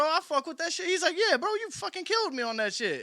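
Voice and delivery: high, hyped-up praise voice; mock praise. Rapper praising his collaborator, high mock hype. [name] praising brother, retold.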